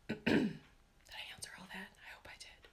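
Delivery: hushed, voiceless muttering to herself; under-the-breath self-check; whispered aside. Real, not acted.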